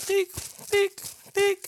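high beeping checkout scanner sound. Retold or imitated. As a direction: beep like a till scanner. Imitating scanner beep.

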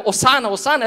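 high, loud cry of hosana; crowd shouting hosana. Retold or imitated. Preacher reenacting a crowd, shouting hosana. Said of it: "las personas que estaban gritando".

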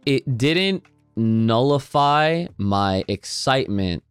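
ordinary talk

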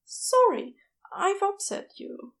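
high, shy and hopeful; tentative, voiced well up high; Aziraphale's voice. A narrator's reading. Shy nurse admitting he likes the nickname, high and hesitant.

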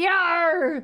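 loud, high pirate call; pirate yar. Pretend. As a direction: growl it like a pirate. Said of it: "Talk Like a Pirate Day".

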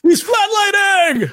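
very high, drawn-out hammed-up wail; mock panicked cry. Echoing the flatlining line from medical dramas, mock panicked cry. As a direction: wail it slowly like an overacted medic.

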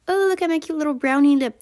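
high, loud, sugary mock influencer gush; cutesy Hailey Bieber. Mocking Hailey Bieber admiring her lips on camera, high sugary coo. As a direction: coo over your own lip look. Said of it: "she was like".

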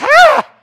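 high, loud mock shriek; loud startled cry. Witch cries out seeing Samuel, loud startled cry. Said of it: "cries out in a loud voice".

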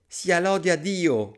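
high, drawn-out praise; relieved thanks. A narrator's reading. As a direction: let out a slow, thankful exclamation. Relieved at finding a shell.

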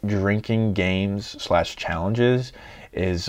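ordinary talk, nothing marked

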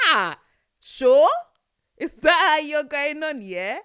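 loud, fast confrontational challenge; heated challenge. Pretend. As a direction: square up to the officer. Confronting police in imagination.

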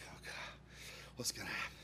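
breathy hush, low and fearful; retold private panic, barely voiced; whispered dread. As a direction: whisper anxiously to yourself. Dread as man walks away.

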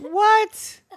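very high, loud outraged squeak; squeaky shocked what. Acted. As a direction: blurt in outrage. Wolf shocked about bike engine.

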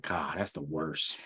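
low, soft, slow mock judgment; disgusted aside. Imagining someone's thought behind a face, quietly disgusted. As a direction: grumble it quietly, as a private thought.

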